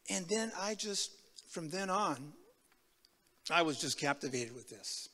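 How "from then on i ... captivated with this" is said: ordinary talk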